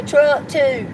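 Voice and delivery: high, loud Southern comeback; imitated telemarketer reply. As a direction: play up the drawled answer to the callers. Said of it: "I say, I say".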